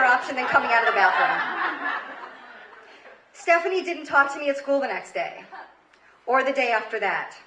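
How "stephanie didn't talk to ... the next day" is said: ordinary talk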